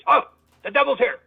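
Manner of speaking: high, loud cry of alarm; retold panic. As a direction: imitate a man suddenly freaking out. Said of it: "the guy's just freaking out".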